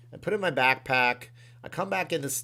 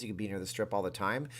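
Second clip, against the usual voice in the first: usual speaking voice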